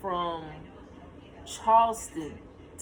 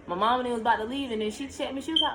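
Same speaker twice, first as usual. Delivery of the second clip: ordinary talk, nothing marked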